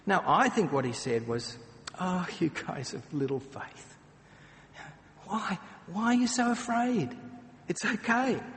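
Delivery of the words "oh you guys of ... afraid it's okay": higher, tender and patient; loving teacher calming his students; gentle reassurance. Shown as a demonstration. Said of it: "He was a loving teacher. He was a patient guide".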